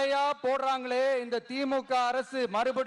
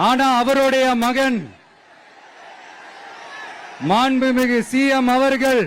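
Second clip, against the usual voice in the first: loud campaign oratory over a crowd; rally speech; 9 dB louder than usual. Real, not acted. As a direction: rouse the Tiruvarur crowd, mocking the CM. Loud rally oration in Tiruvarur. (உரத்த பிரச்சார மேடைப் பேச்சு)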